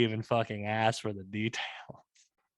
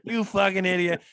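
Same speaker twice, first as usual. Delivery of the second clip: loud, raised-pitch insult; mock-angry insult; 7 semitones higher, 5 dB louder than usual. Castaway insulting Brad over the card game, mock-angry. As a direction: throw back the insult in pretend outrage. Acted card quarrel on the island.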